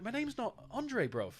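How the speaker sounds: put-on slow, high London accent, stretched out; a footballer drawling in a London accent, clearly annoyed. Retelling the player's reply to the interviewer in a London accent. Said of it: "he has a London accent".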